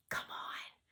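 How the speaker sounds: whispered urging; girl luring her friend to break a rule, hushed. Acted. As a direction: whisper it, coaxing a friend along. Sneaking into the library room.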